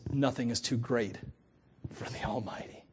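soft, fading to a whisper on the Almighty; sermon point dropped to a reverent whisper; hushed awe. Real, not acted. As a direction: breathe the last words in awe. Awed emphasis in sermon.

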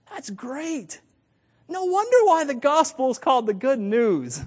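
high, ringing praise of the good news; preacher's elated cry; joyful exclamation. Real, not acted. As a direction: let the wonder burst out, lifted high.

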